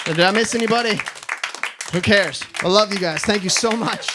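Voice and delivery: loud, high show-closing thanks; calling to crowd. Real, not acted. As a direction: project big to rally the applause.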